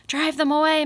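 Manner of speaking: high, loud war cry; shout. A narrator's reading. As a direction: command the beavers, loud and high. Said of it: "Mister Kanad shouted".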